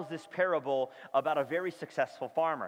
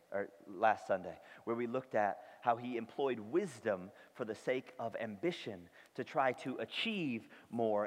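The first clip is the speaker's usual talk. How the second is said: ordinary talk, nothing marked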